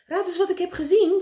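high, loud, eager burst; bursting to tell the news; excited. A narrator's reading. Dolly flies in with news for meneer Pen, excited. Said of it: "Ze was erg opgewonden".

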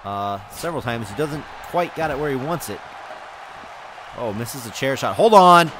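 ordinary talk

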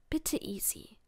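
soft begging whisper; whispered plea. Acted. Fred begs Easy on the phone, soft whispered plea. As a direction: plead quietly, barely voiced.

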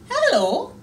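high, loud happy greeting; excited cry. A narrator's reading. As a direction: call out hello with joy. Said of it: "cried the bear".

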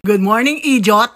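cheery loud greeting; loud teasing greeting. Real, not acted. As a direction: call it out loudly and teasingly. Mom greeting loudly.

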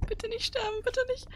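small high pleading voice; high scared plea. Real, not acted. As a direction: beg softly not to die. Begging not to die in game.

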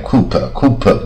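COOPER said as "koop", with the p heard; sounded p. Shown as a demonstration. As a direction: read the p aloud, as in "Cooper". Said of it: "P不发音".

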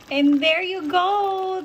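high, slow, loud announcement; excited announcing. Real, not acted. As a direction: sing it out triumphantly. Big announcing finish of cooking.

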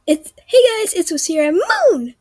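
hyped-up mock channel greeting; YouTuber greeting. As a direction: announce yourself to your viewers. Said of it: "other greetings or goodbyes".

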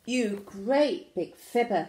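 loud, slow storybook indignation; hammed-up scolding; acted accusation. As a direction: punch out the insult with mock outrage. Flynn accuses the dinosaur of lying.

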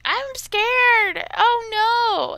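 high, loud mock panic; mocking scared chicken. Mocking the chicken hiding behind a wall, high mock panic. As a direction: squeal it like a cowardly chicken. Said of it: "and it's like".

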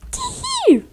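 very high squeaky giggle. Retold or imitated. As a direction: squeak it like a tiny creature. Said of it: "изобразить такой писклявый голос". (писклявый голосок)

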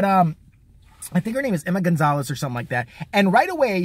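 ordinary talk

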